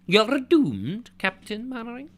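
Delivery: a jokey Scottish doom-monger; mock Scottish accent. Quoting a sitcom line for fun, light Scottish accent. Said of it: "with a Scottish accent".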